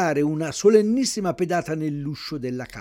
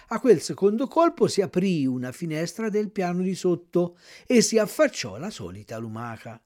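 ordinary delivery

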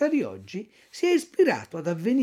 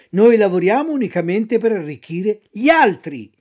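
louder pious fox; sly fox; 4 semitones higher, 7 dB louder than usual. Acted. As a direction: play the saintly fox. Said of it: "riprese la volpe".

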